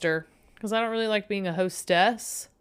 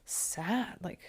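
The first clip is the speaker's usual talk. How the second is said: mocking inner voice; 4 dB quieter than usual. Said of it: "this voice in my head was like".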